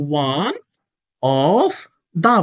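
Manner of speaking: ONE, OF, THE each held apart by pauses; word-by-word dictation. Shown as a demonstration. Dictates words while placing them.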